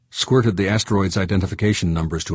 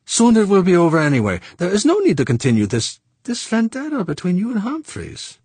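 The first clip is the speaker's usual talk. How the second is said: high, louder French voice, brushing it off; dismissive Frenchman; 13 semitones higher, 4 dB louder than usual. Acted. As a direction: wave away the vendetta to Fuchs. Saint Clair dismissing the feud.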